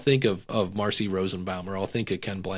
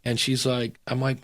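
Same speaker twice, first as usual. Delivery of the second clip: ordinary delivery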